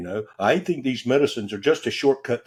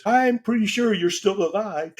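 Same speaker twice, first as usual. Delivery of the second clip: higher, dry reassurance from his friend; amused friend; 9 semitones higher than usual. Retold or imitated. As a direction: humor him, deadpan. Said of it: "And he says".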